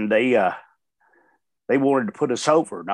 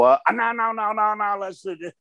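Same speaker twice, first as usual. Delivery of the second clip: mock refusal; 9 semitones higher than usual. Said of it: "I'll be hollering".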